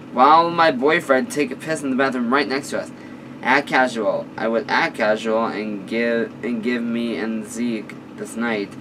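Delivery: ordinary delivery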